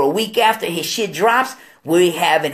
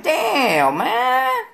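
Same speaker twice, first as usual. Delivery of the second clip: dismayed exclamation; 8 semitones higher than usual. Retold or imitated. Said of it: "I'm like".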